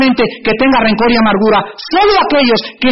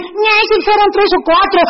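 mock complaint; 7 semitones higher than usual. Mimics congregants complaining over small offences.

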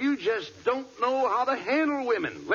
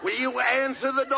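higher, insistent call through the door; impatient, raised-pitch call; impatient repeat; 4 semitones higher than usual. Acted. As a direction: demand an answer. Repeats it when nobody answers.

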